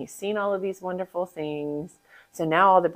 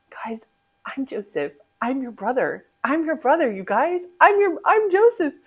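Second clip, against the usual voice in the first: emotional reveal; 8 semitones higher, 6 dB louder than usual. Acted. Joseph reveals himself.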